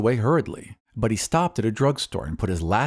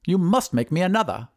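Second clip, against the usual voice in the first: high, insistent wizard; 8 semitones higher than usual. A narrator's reading. Wizard orders another dog, high character voice. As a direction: demand it in a lifted voice. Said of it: "said the wizard".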